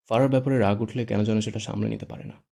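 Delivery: plain reading voice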